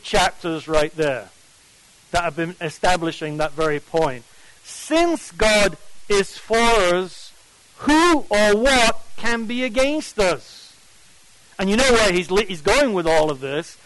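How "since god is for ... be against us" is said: "Since" slow and leaning, the whole verse deliberate; stress on since. Shown as a demonstration. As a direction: read the verse slowly, pressing "Since" and "who". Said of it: "the word since".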